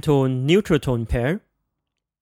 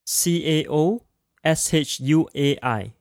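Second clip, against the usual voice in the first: usual speaking voice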